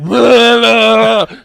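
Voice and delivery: loud, high blubbering noise; imitated runny-nose noise. Patient imitating their running nose, loud blubber. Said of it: "my nose is like".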